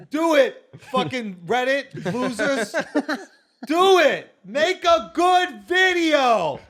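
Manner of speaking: loud, slow shouted demand; shouting. Acted. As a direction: yell it at the viewers. Yelling at Reddit viewers.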